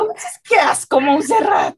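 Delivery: high teasing parody of her friend; mocking echo. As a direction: parrot her words back, high and mocking.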